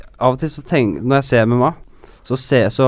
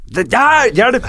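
high-pitched mock scream for help; half-serious squeal of distress; mock cry for help; 12 semitones higher, slower than usual. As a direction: play at crying out for rescue, pitched way up. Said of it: "Skriker de liksom av og til?".

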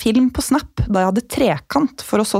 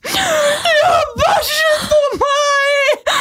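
wailing confession; much slower than usual. Retold or imitated. Confessing to mum on the doorstep, high crying wail. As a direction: burst into tears as you confess.

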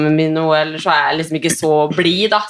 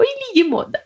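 mother imitates her toddler copying her; imitating little daughter. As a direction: parrot it back in a tiny child's voice. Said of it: "sier hun".

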